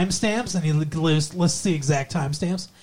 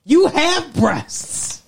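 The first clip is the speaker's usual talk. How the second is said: very slow, much higher, stretched out; exasperated disbelief; 9 semitones higher, much slower than usual. Real, not acted. Telling her co-host she should know better, very slow and exasperated. Mocking co-host's comparison.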